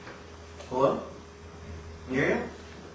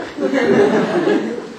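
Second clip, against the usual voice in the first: big high belly laugh; loud hearty laugh; 11 semitones higher, 11 dB louder than usual. Acted. Producer laughing at old flame's slip, loud. Host laughs at her answer.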